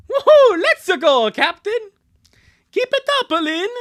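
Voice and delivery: high, loud whooping; root for a teammate at the top of your voice; hammed-up, high-pitched cheers; acted cheering.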